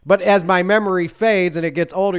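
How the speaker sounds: normal talking voice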